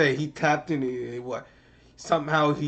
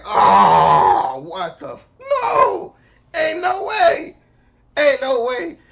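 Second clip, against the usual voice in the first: loud, hammed-up disbelief, leaping high; outraged shouting; 11 semitones higher, 15 dB louder, much slower than usual. Fan rejecting the Spider-Man scene, hammed-up outraged shout. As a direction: yell it like a fan in total denial. Acted outraged reaction to the film.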